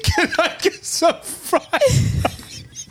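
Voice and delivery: high, slow and laughing; mock him through giggles; laughing tease. Real, not acted. Teasing friend's fake order.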